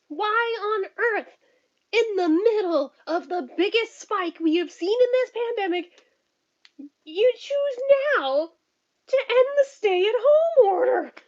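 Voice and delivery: high, loud, outraged rant; heated rant. Real, not acted. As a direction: tear into the governor, voice climbing. Ranting at governor's reopening.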